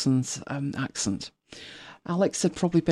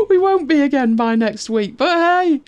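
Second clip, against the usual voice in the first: laughing; 10 semitones higher, 6 dB louder than usual. Real, not acted. Laughing at being behind schedule.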